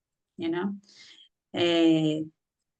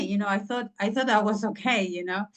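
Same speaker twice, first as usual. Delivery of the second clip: ordinary delivery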